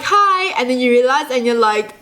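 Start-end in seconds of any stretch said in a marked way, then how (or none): 0.0-0.5 high, eager little "hi"; eager greeting. Acted. Greeting someone who seems to be waving, eager high hi. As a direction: call out a high, friendly hi. Acts out a mistaken friendly wave.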